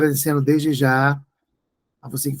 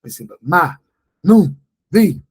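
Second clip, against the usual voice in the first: "ma, nu, vi" said as separate syllables, the last ones louder; syllable stress demo; 8 dB louder than usual. Shown as a demonstration. Teacher showing proparoxytone stress, syllables clearly split. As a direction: beat out "ma, nu, vi" syllable by syllable. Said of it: "antepenúltima".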